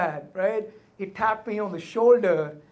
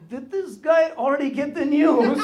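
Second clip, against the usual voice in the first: laughing disbelief; 7 semitones higher than usual. Retold or imitated.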